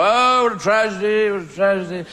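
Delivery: theatrical grieving lament; hammed-up mourner. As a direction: overdo the tragic mourner. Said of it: "slightly more G'd up".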